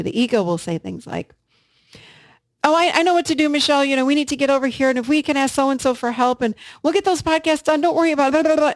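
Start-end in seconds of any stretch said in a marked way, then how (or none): 2.6-8.3 high, loud, rushed know-it-all ego; ego voice, loud and quick. Retold or imitated. Voicing her ego to listeners, high, loud and hasty. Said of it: "the ego comes in loud and speaks quickly". 8.3-8.7 high, loud mock blah blah; ego chatter. Mocking her ego's chatter, high and loud. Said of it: "the ego comes in loud and speaks quickly".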